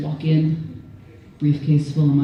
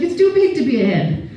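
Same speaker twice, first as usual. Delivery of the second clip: higher, louder mom objecting; imitating mom; 11 semitones higher, 4 dB louder than usual. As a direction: object flatly like a mother. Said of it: "And she's like".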